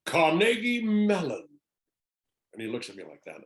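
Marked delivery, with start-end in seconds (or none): 0.1-1.5 high, loud, slow proclamation; Jeff Goldblum. Retold or imitated. Actor posing on stairs greets stranger, grand drawn-out proclamation. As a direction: draw out each word, striking a pose. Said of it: "strikes a pose".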